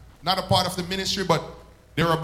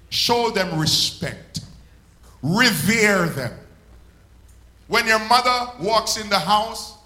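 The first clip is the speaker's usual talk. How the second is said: full-force preaching; 4 dB louder than usual. Acted. Preaching to the congregation.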